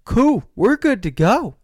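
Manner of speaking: self-quote. Retold or imitated. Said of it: "I'm like".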